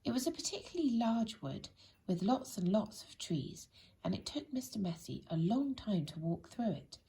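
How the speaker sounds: ordinary delivery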